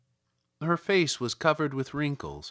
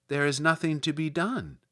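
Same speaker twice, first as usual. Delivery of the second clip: ordinary delivery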